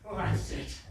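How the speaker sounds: ordinary talk, nothing marked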